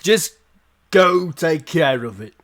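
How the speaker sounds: gruff guard dismissing the party. Acted. NPC guard replies.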